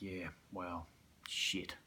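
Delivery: whispered, defeated; giving in at the bottle shop fridge; whispered resignation. Retold or imitated. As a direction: let it slip out under your breath.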